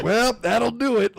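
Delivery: deadpan dying quip again, voice up; laughing retelling of the dying line; dying Goblin King. Retold or imitated. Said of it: "he goes".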